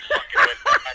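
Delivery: laughing loudly through the words; can't hold back the laugh. Real, not acted. Telling his buddy the story, laughing out loud. Retelling prank, bursts out laughing.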